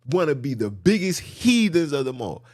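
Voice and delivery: usual speaking voice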